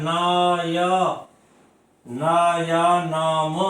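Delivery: ना य drawn out very slowly, almost sung; sing-song ना य नाम, each syllable long; slow syllable breakdown. Shown as a demonstration. Teacher to class, stretching ना य नाम to show the sound. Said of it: "इस अय का उच्चारण इस ना से चालू हो रहा है".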